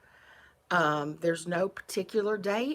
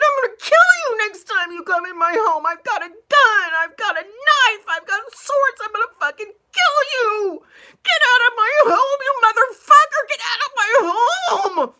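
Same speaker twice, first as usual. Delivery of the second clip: much higher, much louder shriek with cracks and crying; screaming in rage; 15 dB louder than usual. Retold or imitated. Woman screaming threats to drive the intruder out, furious shrieking. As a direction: scream her threats at the top of her lungs. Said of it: "And I started screaming".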